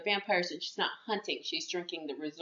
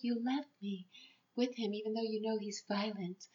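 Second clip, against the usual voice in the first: hurt retold reproach; 2 semitones higher, 9 dB quieter than usual. Girl reproaching the one who left her, hurt retold reproach. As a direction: reproach quietly, lightly acted.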